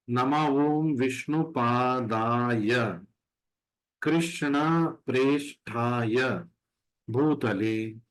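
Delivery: very slow, each syllable spelled out; slow shloka recitation. Shown as a demonstration. Teacher slowly reading the Prabhupada Pranati for students. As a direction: recite the Sanskrit line slowly for learners. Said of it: "this is for learning Sanskrit".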